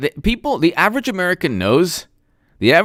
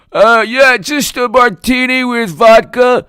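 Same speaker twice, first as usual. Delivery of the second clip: mock bar order voice; 7 semitones higher, 4 dB louder than usual. Ordering martini in voice.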